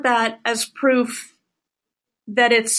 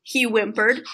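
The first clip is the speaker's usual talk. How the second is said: ordinary talk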